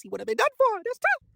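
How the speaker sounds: very high-pitched squeal of commentary; exclaiming. Real, not acted. Comic fan reacting to a what-if, squeaky high exclamation. Exclaiming over a hypothetical outcome.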